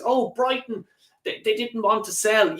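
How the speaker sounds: high-pitched mimicry of a feeble excuse; mock excuse. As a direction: parody someone making lame excuses.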